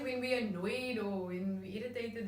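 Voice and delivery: ordinary talk, nothing marked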